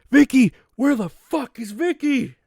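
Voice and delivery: very high, put-on wailing voice; frantic impression voice. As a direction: cry out for someone in a hammed-up squeal. Sudden acted cry for Vicky.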